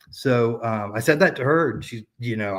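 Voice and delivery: usual speaking voice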